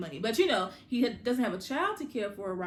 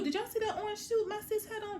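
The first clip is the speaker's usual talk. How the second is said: much higher, squealing gush; excited; 11 semitones higher than usual. Real, not acted. Vlogger raving to viewers about the suit, high excited gush. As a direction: gush over the outfit in a high voice. Gushing over the orange suit.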